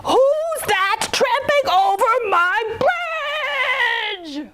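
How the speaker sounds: high, slow, strained troll roar. A narrator's reading. Troll challenges the biggest goat, slow high roar. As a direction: roar it out, stretching the last word. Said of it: "roared the troll".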